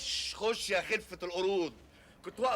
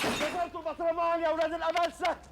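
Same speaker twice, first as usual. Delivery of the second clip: angry scolding; 6 semitones higher, 3 dB louder than usual. Acted. Shopkeeper scolds boys, broken jar.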